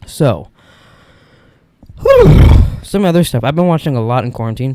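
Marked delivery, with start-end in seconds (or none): 2.0-2.6 loud high whew. Real, not acted.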